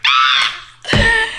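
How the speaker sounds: high, loud squeal of delight; swooning squeal. Retold or imitated. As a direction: shriek like you just melted. Recalls eye contact, melting.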